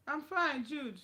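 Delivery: ordinary delivery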